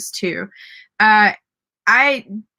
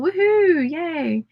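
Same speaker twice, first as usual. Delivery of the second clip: high, drawn-out little cheer; 8 semitones higher, much slower than usual. Real, not acted. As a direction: celebrate with a slow, high whoop. Cheering a viewer's progress.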